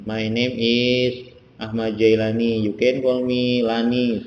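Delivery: each English word spaced out slowly, like a teacher; slow model sentence. Shown as a demonstration. Teacher shows students how to introduce themselves, slow and clear. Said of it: "langsung sebutin namanya di sini".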